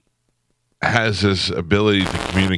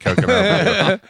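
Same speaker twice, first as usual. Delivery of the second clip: eager waiter; 11 semitones higher than usual. Retold or imitated. Waiter answers Pete.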